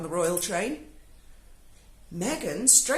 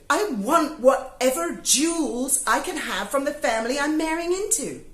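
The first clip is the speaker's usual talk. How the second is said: louder, higher greedy wish-list voice; mocking Meghan; 4 semitones higher, 5 dB louder than usual. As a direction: lightly voice a grasping bride. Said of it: "I've got Meghan's wish list here".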